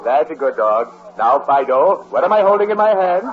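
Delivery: ordinary delivery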